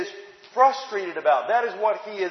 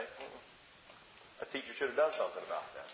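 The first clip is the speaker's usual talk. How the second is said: hushed, fast, disapproving whisper; tut-tut under your breath; 8 semitones lower, 16 dB quieter than usual. Acted. Disapproving judgment of the teacher.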